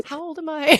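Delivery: high-pitched mock question to oneself; lightly acted self-check, pitched well up. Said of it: "I was like".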